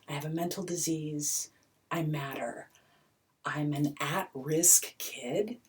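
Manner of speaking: ordinary talk, nothing marked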